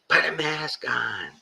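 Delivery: high, slow, nagging shopper voice; mock shrill scolding. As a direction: mimic an outraged masker. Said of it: "are screaming at you".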